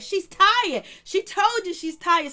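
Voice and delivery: high, loud, slowed mock yell of Cecil; mock shout. Cecil cutting in for Toya at the party, high loud mock yell.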